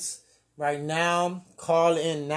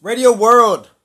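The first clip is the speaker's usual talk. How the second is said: calling out; 6 semitones higher, 14 dB louder, slower than usual. Real, not acted. Host calling out to listeners.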